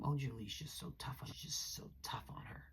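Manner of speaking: whispered mock of the ex smearing her; voicing the ex as he badmouths Julie, in a whisper. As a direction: hiss his gossip behind her back. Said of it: "he definitely started smearing my name".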